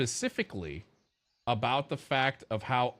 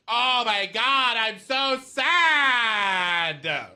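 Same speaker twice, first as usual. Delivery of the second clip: higher, louder, very slow whine, a hammed-up mock sob; whiny mock sad girl; 11 semitones higher, 8 dB louder, much slower than usual. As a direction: drag out the fake sadness like a spoiled girl. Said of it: "She's like".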